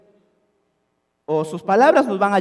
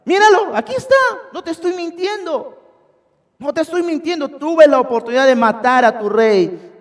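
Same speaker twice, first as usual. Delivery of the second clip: much higher, pleading insistence; insistent; 9 semitones higher, 5 dB louder than usual. Acted. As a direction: hold up the proof and insist. David shows the spear, insisting.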